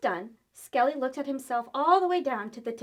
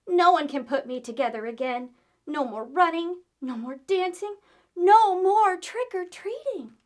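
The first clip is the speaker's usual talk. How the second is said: despairing wail; 5 semitones higher, 8 dB louder than usual. Acted. Skelly despairs in a pile.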